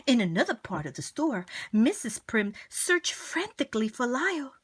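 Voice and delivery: plain reading voice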